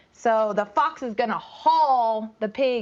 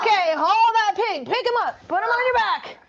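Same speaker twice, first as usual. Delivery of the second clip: excited coaching; 9 semitones higher than usual. Real, not acted. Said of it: "coaching kids to act".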